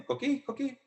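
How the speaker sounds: high chirpy frog call; coqui frog call. Retold or imitated. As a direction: chirp the frog's name up high. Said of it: "in Spanish it goes".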